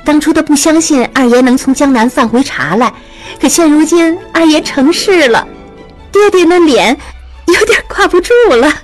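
amused calling. A narrator's reading. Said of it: "玉汉笑着在屋内喊曹氏".

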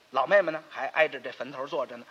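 ordinary delivery

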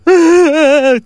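high loud fake wail; a hammed-up sobbing wail; mock wailing. The widow fakes tears for the fat man, wailing. Woman fakes crying for money.

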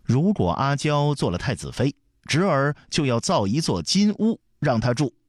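put-on high boyish solemn promise; mock-earnest. A narrator's reading. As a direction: declare it as a child playing serious. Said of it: "装出一本正经的样子". (孩子装得一本正经)

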